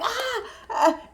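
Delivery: high, startled "ah" sounds; mock collective shock; imitated shocked gasps. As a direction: gasp high like a stunned table. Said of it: "Everybody at the table like".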